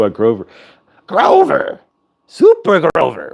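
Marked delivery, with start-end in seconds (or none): 1.1-1.8 high, squeaky Muppet Grover cry. Retold or imitated. To the group, doing Grover from the Muppets, squeaky and high. 2.3-3.3 high Muppet Grover, slow. Retold or imitated. Recalling Super Grover for the group, high Muppet voice.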